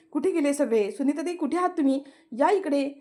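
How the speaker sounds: उंच आवाजात घाबरून हाका मारणं; सगळ्यांना जोरात हाक मार; ओरडून हाक. Retold or imitated. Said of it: "ती जोरजोरात ओरडते". (घाबरलेली, मोठ्याने हाक मारणारी आनंदी)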